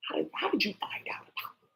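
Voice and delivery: soft unvoiced whisper asking how you were found; hushed whisper. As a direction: breathe it out as if afraid to be heard. Hushed voice of the spied-on.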